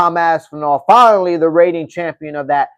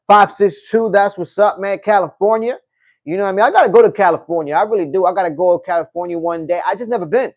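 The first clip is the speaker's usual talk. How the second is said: normal talking voice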